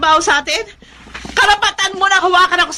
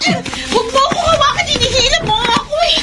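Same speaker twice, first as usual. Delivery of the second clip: high pitched protest against being pulled; wife yanked by the arm, protesting high; angry protest; 8 semitones higher than usual. Acted. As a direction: fight him off with your voice, much higher. Husband drags wife home.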